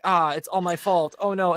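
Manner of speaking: high, drawn-out mock despair; hammed-up anime self-blame; anime dramatic. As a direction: wail it lightly like an anime character. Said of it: "like, the anime dramatic".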